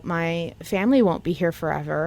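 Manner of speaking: ordinary delivery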